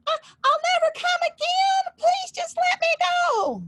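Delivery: a trapped little devil begging, voice cracking high and loud; pleading little devil. Acted. Trapped little devil begs the blacksmith, high crying plea.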